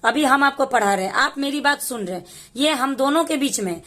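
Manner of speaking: usual speaking voice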